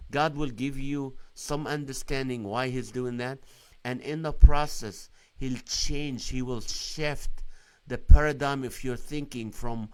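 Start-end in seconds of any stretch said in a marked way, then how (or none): none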